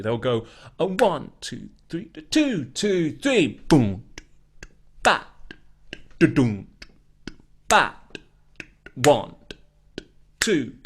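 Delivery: a one two three, said in rhythm; rhythmic count with beatbox sounds; drummer count-in. Shown as a demonstration. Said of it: "the drummer will usually count like this".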